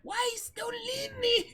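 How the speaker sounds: put-on very high squeaky girl voice, slow; Ruby Rose voice. Friend asked to voice Ruby Rose, squeaky and high. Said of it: "voice Ruby Rose".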